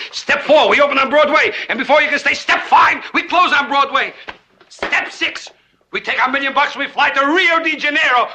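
high, mounting exhilaration; excited pitch. Acted. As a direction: sell the grand plan with soaring excitement. Pitching the scheme, building up.

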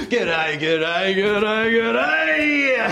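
Australian accent, rising each time; Aussie g'day. Shown as a demonstration. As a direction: keep climbing the Australian g'day. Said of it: "That's how you do it in Australia".

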